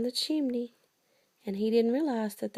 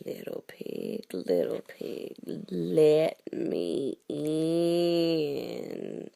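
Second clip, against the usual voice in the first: deep, slow wolf voice at the door; big bad wolf; 5 semitones lower, much slower than usual. Parent voicing the wolf to a child, deep and slow. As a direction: growl it low like the wolf.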